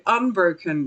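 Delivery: ordinary delivery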